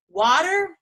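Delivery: high, loud scoff; as if it's a silly question; a quick surprised echo; incredulous. Acted.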